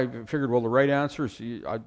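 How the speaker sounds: ordinary talk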